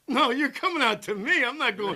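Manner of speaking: retold loud refusal with a laugh; defiant refusal. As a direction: throw it back at the cop, half-laughing. Defiant retelling of refusing precinct.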